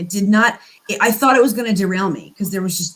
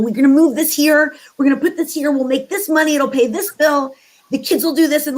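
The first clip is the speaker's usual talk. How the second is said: controlling planner; 6 semitones higher than usual. Retold or imitated.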